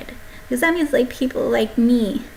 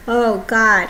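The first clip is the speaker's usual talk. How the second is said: ordinary talk, nothing marked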